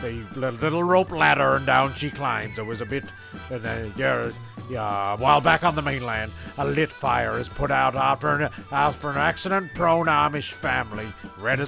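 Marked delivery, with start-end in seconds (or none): none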